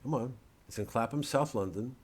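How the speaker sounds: usual speaking voice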